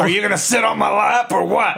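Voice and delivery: pushy Santa voice. Retold or imitated. Voicing the mall Santa.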